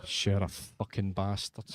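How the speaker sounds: normal talking voice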